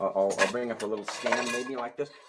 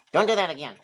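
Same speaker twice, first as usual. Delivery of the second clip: a higher put-on puppet voice; dog puppet voice; 5 semitones higher, 7 dB louder than usual. Dog puppet scolding the ventriloquist, puppet voice. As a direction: speak as the little talking dog.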